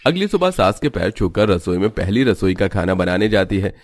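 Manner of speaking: ordinary delivery, nothing marked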